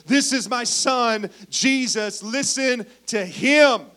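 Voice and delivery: slow, loud, solemn divine pronouncement; voice of God. Acted. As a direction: proclaim it slowly like God from the cloud. God's voice from cloud.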